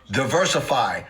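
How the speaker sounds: usual speaking voice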